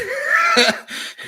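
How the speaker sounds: high, loud burst of laughter; laughing. Real, not acted. Laughs at being nuked twice.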